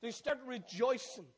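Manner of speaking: loud, rising, jubilant sermon voice; fiery revival preaching; preaching at full force. Acted. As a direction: build the praise to a climax. Preaching climax, song and praise.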